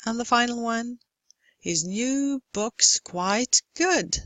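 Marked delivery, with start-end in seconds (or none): 1.6-4.2 "new", "book's", "good" drawn out in even time; slow stressed beats. Shown as a demonstration. Lecturer gives short phrase, "new book's quite good" slowly stressed. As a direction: stretch each word of "new book's quite good" across the beat. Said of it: "same rhythm as the shorter".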